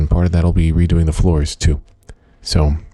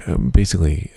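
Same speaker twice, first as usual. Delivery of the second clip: ordinary delivery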